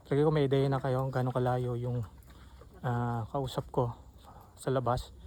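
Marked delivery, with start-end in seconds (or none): none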